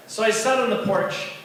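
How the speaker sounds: ordinary talk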